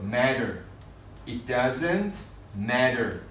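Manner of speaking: MATTER with the tt softened to a quick d; American flapped T. Shown as a demonstration.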